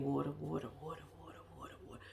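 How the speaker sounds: water chanted, fading to a whisper; mumbling reminder fading out; fading mantra. Retold or imitated.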